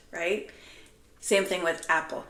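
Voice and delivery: normal talking voice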